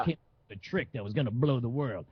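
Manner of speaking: lightly voiced washed-up bragger, a little low; a hint of the guy boasting about his glory days; office party has-been. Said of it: "the guy at the office party would be like".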